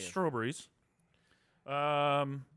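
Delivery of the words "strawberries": soft, low mock-British echo; British accent attempt. Shown as a demonstration. Host repeats co-host's word, quiet British accent attempt. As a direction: quietly practise the British pronunciation.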